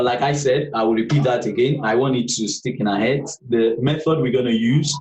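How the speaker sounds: usual speaking voice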